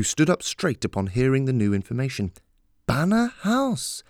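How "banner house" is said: high, slow surprised question; drawn out in surprise. A narrator's reading.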